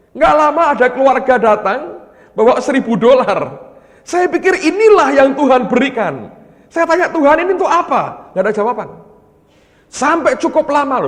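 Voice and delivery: loud, high, ringing preaching; preaching at full force. Acted. Preacher urging the congregation not to cling to wealth, loud and high. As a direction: drive the point home to the congregation.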